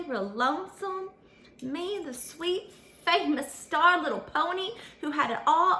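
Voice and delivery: ordinary delivery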